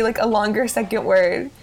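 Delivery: ordinary talk, nothing marked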